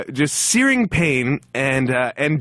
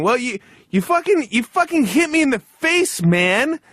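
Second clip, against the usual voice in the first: retold screaming; 10 semitones higher than usual. Narrator screaming at coworker as the plane lands, high angry yell. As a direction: sputter the accusation in a mock rage. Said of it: "we were screaming at each other".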